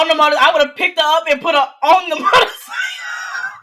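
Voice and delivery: loud, high shout breaking into laughter; excited shouting. Real, not acted. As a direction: shout the boast over the laughing group. Joking boast over everyone's laughter.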